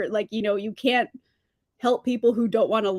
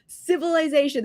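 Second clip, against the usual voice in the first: infuriated; 7 semitones higher, 4 dB louder than usual. Real, not acted. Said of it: "I am infuriated".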